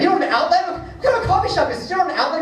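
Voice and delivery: high-pitched incredulous customer; crack-fiend outrage. Acted. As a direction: squawk it like a desperate addict. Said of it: "they look like a crack fiend".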